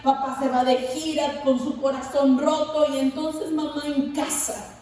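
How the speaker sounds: ordinary delivery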